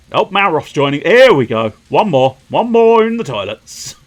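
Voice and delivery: loud, gleeful commentary; excited shout. Real, not acted.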